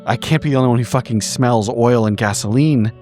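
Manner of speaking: ordinary talk, nothing marked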